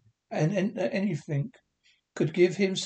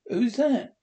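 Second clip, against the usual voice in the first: startled question; 7 semitones higher, 4 dB louder than usual. A narrator's reading. Fred surprised at visitor.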